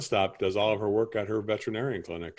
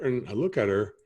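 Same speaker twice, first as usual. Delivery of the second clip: ordinary talk, nothing marked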